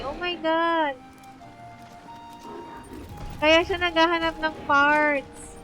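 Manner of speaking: ordinary talk, nothing marked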